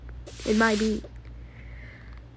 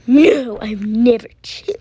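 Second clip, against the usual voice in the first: normal talking voice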